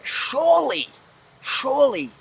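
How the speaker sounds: high, insistent appeal; exasperated incredulity. Real, not acted. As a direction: pitch leaps up in disbelief. Exasperated rant about carbohydrates.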